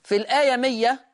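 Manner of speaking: ordinary talk, nothing marked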